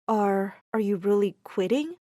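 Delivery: soft, low, faltering question; subdued and tentative; hesitant question. A narrator's reading. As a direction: ask gently, afraid of the answer. Hesitant question after a hug.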